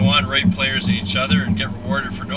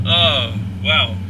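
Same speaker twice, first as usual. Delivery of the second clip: normal talking voice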